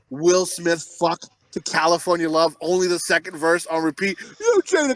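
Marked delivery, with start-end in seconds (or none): 4.4-4.9 high-pitched mock complaint. Husband objecting to his wife's song, mock plea. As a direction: pipe up as a pestered husband. Acted [name] pleading with wife.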